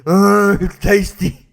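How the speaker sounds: dopey voice loving the taste; mocking put-on voice. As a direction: savour it like a dim-witted fool. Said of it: "in that kind of voice".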